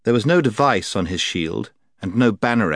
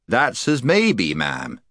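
stiff and guarded. Acted. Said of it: "very stiffly and on his guard".

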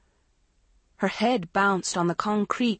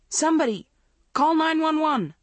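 urgent shout; 9 semitones higher, 4 dB louder than usual. A narrator's reading. Panicked crowd calling for help.

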